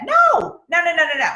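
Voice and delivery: a high, loud cry of no; emphatic no. Real, not acted. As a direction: shoot the excuse down. Rejecting the excuse emphatically.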